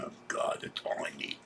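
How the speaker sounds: a barely voiced aside of annoyance; whispered grumble; exasperated whisper. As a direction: whisper it under your breath, fed up. Muttering to herself, annoyed.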